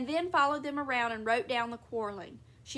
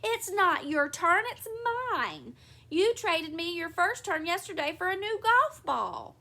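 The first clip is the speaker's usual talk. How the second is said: higher, louder protest; indignant yelling; 5 semitones higher, 4 dB louder than usual. A narrator's reading. Wife pounding on bathroom door, indignant yell. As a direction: yell in outrage through a door. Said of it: "pounding on the bathroom door, yelling".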